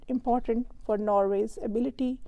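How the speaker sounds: usual speaking voice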